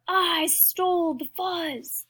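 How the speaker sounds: slow, high, boastful declaration; barked. A narrator's reading. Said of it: "barked a voice from above".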